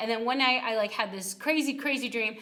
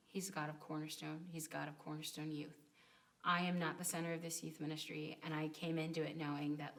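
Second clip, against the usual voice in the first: low, soft, earnest testimony; quiet, tender closing words; heartfelt sincerity; 6 semitones lower, 11 dB quieter than usual. Real, not acted. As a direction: speak softly and low, winding down into encouragement. Closing testimony, heartfelt farewell to youth.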